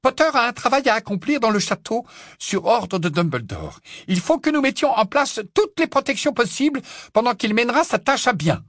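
high, grave stern teacher voice; stern old witch. Acted. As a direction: announce it gravely. McGonagall gives orders.

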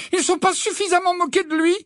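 very high, aggrieved question; pained indignation. A narrator's reading. Hermione protesting the mockery of Ron, pained.

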